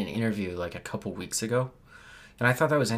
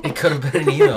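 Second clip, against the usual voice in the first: laughing; 3 semitones higher, 10 dB louder, faster than usual. Real, not acted. Echoes the joke laughing.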